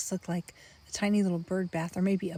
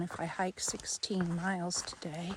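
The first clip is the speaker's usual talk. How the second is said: whispering; 5 semitones lower, 9 dB quieter than usual. Real, not acted. Whispering while hiking alone.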